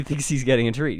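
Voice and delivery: usual speaking voice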